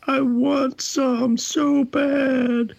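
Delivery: high, slow, drawn-out longing; craving. Retold or imitated. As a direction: stretch it out like you're starving for it. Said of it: "I'm like".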